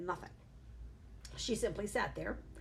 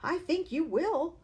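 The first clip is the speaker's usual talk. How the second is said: smug reply; 9 semitones higher, 7 dB louder than usual. A narrator's reading. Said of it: "the mother said".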